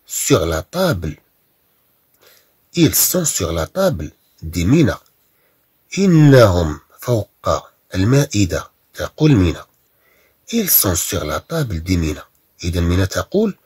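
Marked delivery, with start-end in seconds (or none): 0.1-4.1 very slow, word by word; slow dictation. Shown as a demonstration. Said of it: "dictation reading for class". 10.5-11.8 very slow and softer; slow quiet dictation. Shown as a demonstration. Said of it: "dictation reading for class".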